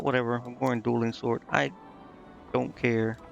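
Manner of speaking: ordinary talk, nothing marked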